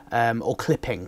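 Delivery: usual speaking voice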